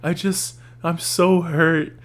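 high, drawn-out imitation of weepy posting; mock crying. Host mocking people who film themselves crying, mock tearful. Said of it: "They, like, cry, and they're like".

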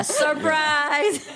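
high, drawn-out "surprise"; mock surprise reveal. Host joking that he gave it away, mock-excited. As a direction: call it out slowly and high, half laughing.

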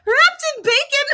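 very high squeal while laughing; helpless laughter. Real, not acted. Co-host derailing the ad with a joke, helpless laughter. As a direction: squeak the words out through laughter.

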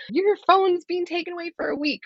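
high-pitched imitation of an angry mom's threat; mock angry threat. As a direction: toss off the threat like a cross mom, pitched well up. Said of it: "I'm angry and I threaten".

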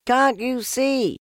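high, loud, slow shout; squeaky piglet shouting. A narrator's reading. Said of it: "shouted Piglet".

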